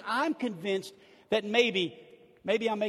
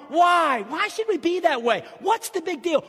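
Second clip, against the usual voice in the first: ordinary talk, nothing marked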